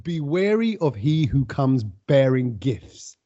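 low, slow proverb delivery; old Englishman. Retold or imitated. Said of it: "The phrase was".

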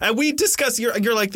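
ordinary delivery

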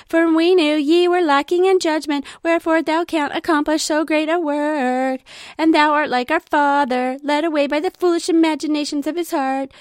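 high, loud taunting whine; whiny mocking brothers. Teacher voices the brothers gloating over sad Nephi, high and whiny. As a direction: taunt him like spoiled older brothers. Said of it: "That's what his brothers sounded like".

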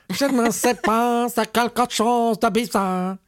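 mock Parisian French. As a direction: put on a haughty Parisian French, teasing. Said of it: "They talk like that".

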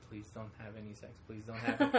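soft, hushed begging; nervous kid pleading quietly; anxious pleading. Retold or imitated. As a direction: pray under your breath the scene stays clean. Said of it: "I was so stressing".

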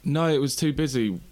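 ordinary talk

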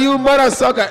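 put-on high, loud African accent comic shout; loud comic catchphrase. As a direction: bellow the catchphrase like on stage. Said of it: "I'm like".

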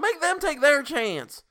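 high, loud, slow yell. A narrator's reading. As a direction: drag out a desperate yell. Tessie keeps yelling.

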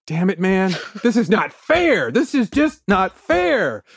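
high, drawn-out whine of complaint; mock upset. [name] reacting to the canceled show, mock-upset whine. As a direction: protest like a thwarted kid, hammed up. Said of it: "he's really upset".

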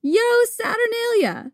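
high, slow, drawn-out festive call; exuberant, jolly cry. Acted. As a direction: sing out the greeting joyfully. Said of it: "equally exuberant and super jolly".

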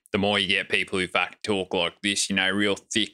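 exaggerated inland Australian accent, vowels stretched; thick Australian bogan accent. Shown as a demonstration. Showing the co-host how inland Australians talk, thick bogan accent. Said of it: "real thick fucking Australian bogan accents".

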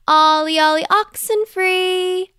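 high ringing shout across the playground; happy game call, shouted high. A narrator's reading. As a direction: call it out loud to everyone hiding. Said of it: "they shouted".

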